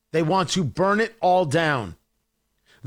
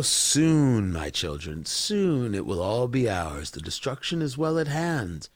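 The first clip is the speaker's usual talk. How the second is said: sinister villain voice; 9 semitones lower, 4 dB quieter than usual. Retold or imitated. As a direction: savour every word like a cartoon villain plotting doom. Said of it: "So they can sit back and go".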